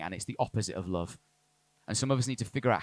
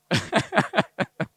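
short high chuckle; laughing at his own tangent; light laugh; 11 semitones higher, 6 dB louder than usual. Real, not acted. Pastor laughing off his tangent, light and high.

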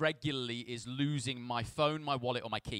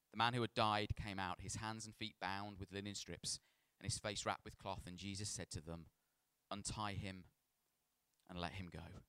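measured, softer narration of a Bible story; scripture reading; 5 semitones lower, 10 dB quieter than usual. A narrator's reading. Preacher reads the raising of Lazarus to church, measured scripture reading. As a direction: read the passage solemnly and evenly.

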